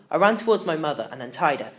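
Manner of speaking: usual speaking voice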